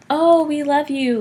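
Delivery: high, drawn-out gushing welcome; exaggerated doting parent; mock gushing. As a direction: gush it loudly, stretching the words. Parents' imagined gushing welcome, mocked.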